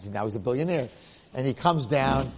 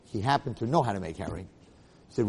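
usual speaking voice

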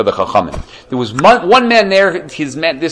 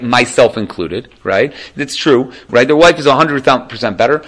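ordinary talk, nothing marked

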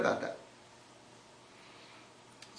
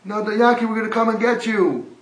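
loud, high shout down a pit; 8 semitones higher, 8 dB louder than usual. Acted. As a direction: call down to reassure him.

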